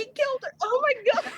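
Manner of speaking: high, stunned cry of disbelief; sudden high-pitched shock; shocked outburst. Real, not acted. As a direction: gasp it out, truly shaken. Shocked at the song's twist.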